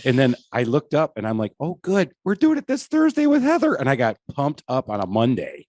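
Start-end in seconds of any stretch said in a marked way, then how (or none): 1.6-3.7 high, squealing delight; mock giddy excitement; excited. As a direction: gush like a thrilled kid. Excited quote of himself.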